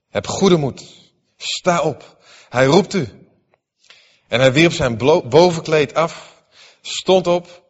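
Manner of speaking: measured, solemn Bible reading; scripture reading. Acted. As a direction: read the passage to the congregation with weight. Bible reading aloud in church. (plechtige schriftlezing)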